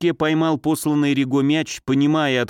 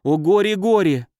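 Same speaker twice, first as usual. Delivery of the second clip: loud, higher wail of grief; despairing wail; 6 semitones higher, 3 dB louder than usual. A narrator's reading. Mother in despair over her daughter, loud wail. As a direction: cry it out as if sobbing. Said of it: "от отчаяния и удушающих рыданий".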